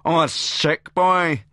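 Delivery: high, loud, drawn-out hoot of derision; hammed-up guffawing mockery; guffawing jeer. A narrator's reading. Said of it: "Mel guffaws".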